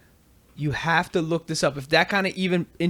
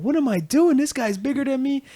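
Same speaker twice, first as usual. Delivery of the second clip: panicked self-doubt; 9 semitones higher than usual. Retold or imitated. Tyson's panicked thoughts retold.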